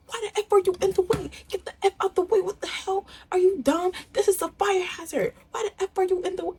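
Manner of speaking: very high-pitched, rapid-fire mock tirade; retold screaming. Vlogger acting out a lady ranting at her on the sidewalk, mock screaming. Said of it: "just starts screaming".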